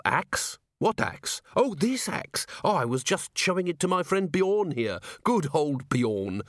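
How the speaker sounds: high-pitched, mock-innocent protest; feigned innocence. Dwarf caught fighting plays innocent to the watchman, feigned innocence. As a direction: play it innocent, pitched well up.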